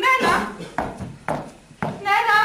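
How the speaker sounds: high, loud call for a servant. Acted. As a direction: summon someone from the next room. Calling the servant from another room.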